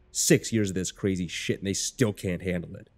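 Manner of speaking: ordinary delivery, nothing marked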